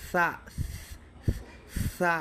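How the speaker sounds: সা begun as a lisped th; careful tha demonstration. Shown as a demonstration. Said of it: "সার উচ্চারণটা একটু খেয়াল করবেন".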